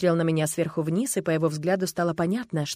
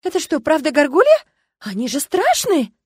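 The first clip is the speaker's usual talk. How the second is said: high, loud, rising incredulous question; shocked disbelief; 9 semitones higher, 9 dB louder than usual. A narrator's reading. Girl asks the dragon about gargoyles, shocked. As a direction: squeal it in alarmed amazement.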